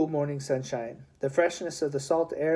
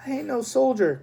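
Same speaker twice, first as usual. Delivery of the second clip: cried out; 10 semitones higher, 4 dB louder than usual. A narrator's reading. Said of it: "cried Charlie".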